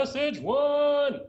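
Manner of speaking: high, loud, drawn-out call; sustained high-pitched shout; yelled broadcast. Shown as a demonstration. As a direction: yell it out slowly like a broadcast. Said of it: "Yell it out right now".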